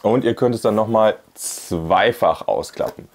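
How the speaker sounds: ordinary talk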